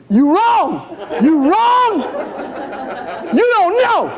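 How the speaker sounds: high-pitched comic yell. Retold or imitated. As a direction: holler back at the television in mock outrage. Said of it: "I'm yelling at the T V".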